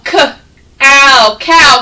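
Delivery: "K-ow" split slowly into k and ow, then "cow" whole; sounded out. Shown as a demonstration. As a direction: break "K-ow" apart, then blend it into "cow". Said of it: "This is a cow".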